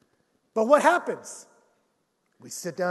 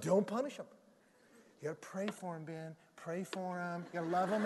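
gentle pastor; 3 semitones lower, 8 dB quieter than usual. Retold or imitated. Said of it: "he was like".

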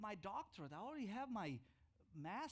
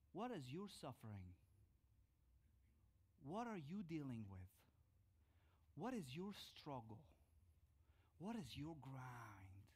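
lower, softer searching questions; gentle appeal; 3 semitones lower, 4 dB quieter than usual. Real, not acted. Pastor asking the congregation about their struggles, soft and gentle. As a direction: ask each one tenderly.

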